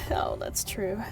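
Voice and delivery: normal talking voice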